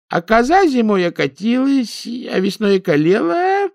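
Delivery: put-on high, thin old granny voice, lamenting; a poor old woman pleading her losses; complaining old village woman. A narrator's reading. Baba Dunya laments to Ninka about her cow and goat in a high old voice.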